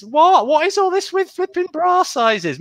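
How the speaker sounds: high-pitched exasperated outburst; mock outrage. Host cuts short another bra size joke, mock outrage. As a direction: break off in high, incredulous protest.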